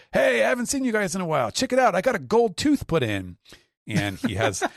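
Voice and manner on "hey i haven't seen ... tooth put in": high, loud fast bragging; boastful Gaimon. Retold or imitated. Gaimon greeting the crew, high loud bragging voice. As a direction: show off the new tooth. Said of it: "he's like".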